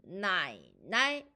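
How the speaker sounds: low drawn 나이, then quick rising 나이; third tone plus neutral tone. Shown as a demonstration. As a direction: sink low on the first 나이, lift the second. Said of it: "라고 발음합니다".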